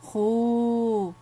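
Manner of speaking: خو held long as a drawn-out "khoo"; sustained long-vowel model; long vowel demo. Shown as a demonstration. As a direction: stretch the "oo" in خو. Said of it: "سأقرأ عليكم".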